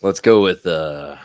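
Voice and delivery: booming, slow announcer hype; monster truck announcer. Pretend. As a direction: sell the card like a monster truck rally. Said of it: "like, monster truck announcer mode".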